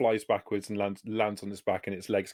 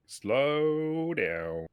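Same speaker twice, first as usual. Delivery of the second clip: drawn-out halting call; 5 semitones higher than usual. Real, not acted. Game master halting eager players, drawn-out call. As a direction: stretch out the words, pitched higher.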